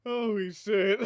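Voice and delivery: loud, very high laughing burst; laughing loudly. Real, not acted. Streamer seeing fan art, loud high laughing. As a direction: explode into squealing laughter.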